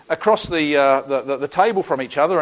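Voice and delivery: ordinary delivery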